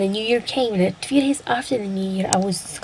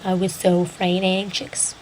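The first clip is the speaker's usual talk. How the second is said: ordinary delivery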